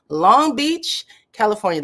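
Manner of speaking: Long Beach called out high and loud, rising; Long Beach burst out loud and high, then California falls; excited shout-out. Real, not acted. Spotting a viewer's city.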